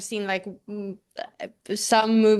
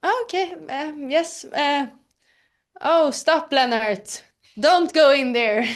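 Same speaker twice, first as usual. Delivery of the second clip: high, animated acted lines; lively in-character line reading; acting a dubbing scene; 6 semitones higher than usual. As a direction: play it like a scared film character. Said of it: "so it was just like".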